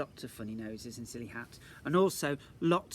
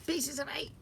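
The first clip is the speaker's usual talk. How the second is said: high squawky parrot; 12 semitones higher than usual. Acted. Storyteller adds the parrot's squawk, high.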